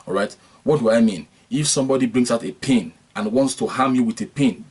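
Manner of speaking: usual speaking voice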